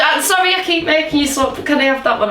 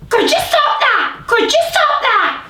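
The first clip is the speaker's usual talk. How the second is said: much higher squawky parrot voice; 11 semitones higher than usual. Pretend. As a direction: parrot it back, high. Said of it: "you talk like a parrot".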